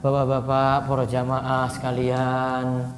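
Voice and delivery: low, soft monotone, dragged out; dull sermon monotone. Shown as a demonstration. Teacher mimics a preacher who loses his congregation, droning monotone. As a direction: drone like a sleepy preacher. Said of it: "apa yang terjadi kalau jamaah kayak gitu".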